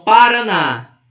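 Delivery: Paraná with a loud, stressed final ná; stress on last syllable. Shown as a demonstration. Teacher showing which syllable is strongest, stress on the end of Paraná. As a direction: lean hard on the ná of Paraná. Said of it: "a última sílaba mais forte".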